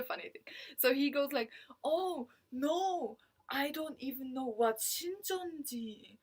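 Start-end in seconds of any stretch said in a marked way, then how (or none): 1.8-6.2 slow, higher, lightly voiced denial; a hint of a flustered recruiter; mock recruiter. Imitating the recruiter playing dumb about the cult.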